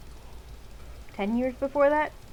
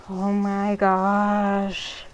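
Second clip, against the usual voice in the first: long, slow exasperated groan of the words; slow disbelieving oh my gosh; 4 dB louder, much slower than usual. Real, not acted. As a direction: drag it out, fed up. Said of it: "These parents".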